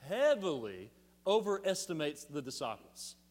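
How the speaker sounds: heavily said long and weighty, falling; stress on heavily. Real, not acted. Preacher stressing heavily to the church, emphatic. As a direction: drive the word heavily home. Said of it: "I can't emphasize that word enough".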